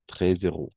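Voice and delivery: "Treize euros" linked as treiz-euros; liaison z. Shown as a demonstration. Teacher shows students the liaison in "Treize euros". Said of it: "On va faire la liaison".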